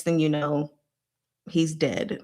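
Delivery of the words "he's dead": ordinary talk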